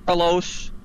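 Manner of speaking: usual speaking voice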